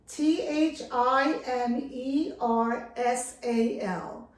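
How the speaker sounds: slow, letter-by-letter spelling. Shown as a demonstration. Vlogger helping viewers look up the word, spelling it out. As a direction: spell it slowly, each letter separate. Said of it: "I'm gonna spell it again".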